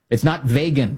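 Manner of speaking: "vegan" said VAY-gun; VAY-gun for "vegan", as the error; quoted mispronunciation. Retold or imitated. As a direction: say "vegan" with an ay sound. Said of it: "he's gotta fix his pronunciation".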